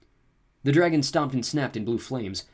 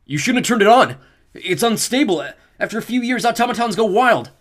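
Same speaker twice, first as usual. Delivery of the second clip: high, loud frantic explanation; panicked yelled warning; breathless shouting; 13 semitones higher, 12 dB louder than usual. A narrator's reading. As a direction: shout while running and gasping. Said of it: "gasped for breath".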